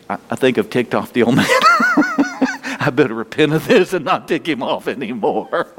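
talking through laughter; real laughing. Real, not acted. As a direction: laugh while joking at your own expense. Laughs at own joke about God.

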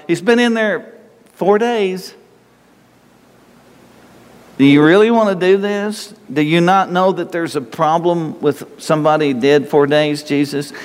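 Martha's fussy protest, pitched high; high mock Martha. Preacher voicing Martha objecting.

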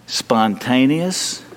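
Spontaneous stretched very slowly with a rising end; drawn-out word. Shown as a demonstration. Preacher to congregation, Spontaneous drawn out slowly. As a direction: say Spontaneous slowly, letting it rise.

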